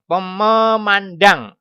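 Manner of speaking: memandang said slowly, the final ang stretched and loud; slow it right down, lean hard on the -dang; drawn-out rhyme ending. Shown as a demonstration. Teacher spelling out the rhyme sound for pupils, slow and emphatic.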